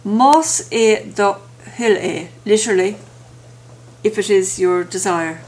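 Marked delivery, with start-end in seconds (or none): 0.1-2.3 slow, every syllable given its full weight; full careful form. Shown as a demonstration. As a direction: spell out Más é do hill é slowly and in full. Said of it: "Said quickly as".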